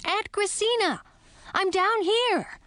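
high shout up to her aunt. A narrator's reading. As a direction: yell up from the floor, thrilled. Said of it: "I shouted".

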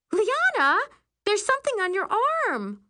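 put-on very high, loud girl's alarm; alarmed outcry. A narrator's reading. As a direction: cry out at a shocking discovery. Bunkmate alarmed at rash.

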